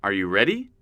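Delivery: usual speaking voice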